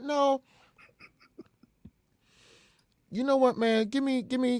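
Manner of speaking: high-pitched, stammering excuse; rattled refusal. Retold or imitated. Prank caller asking for five minutes, rattled.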